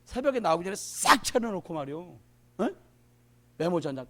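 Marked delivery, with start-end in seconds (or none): none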